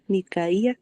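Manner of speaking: Micaía, said mi-ka-I-a with the I stressed; Micaía, lean on the I; Micaía with the accent on the I. Shown as a demonstration. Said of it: "hasta tiene acento en la I".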